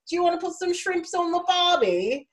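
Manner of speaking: playing the clueless tourist in a mock Aussie voice; mock Australian accent. To Australian friends, a cheesy mock Australian accent. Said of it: "And I was like".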